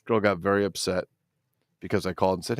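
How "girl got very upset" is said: ordinary talk, nothing marked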